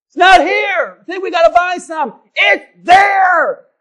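loud, high, drawn-out shouting; hammed-up marital shouting match; shouting argument. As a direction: yell back and forth over the missing cinnamon. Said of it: "I'm getting murdered".

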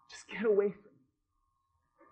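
slow, soft, heavy with shame; ashamed plea. Retold or imitated. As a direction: push him away quietly, slowly. Peter ashamed before Jesus.

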